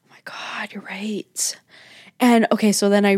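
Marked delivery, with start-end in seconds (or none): 0.1-1.6 stunned hushed whisper; whispered disbelief. Real, not acted. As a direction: whisper back in shock. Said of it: "whispered reply".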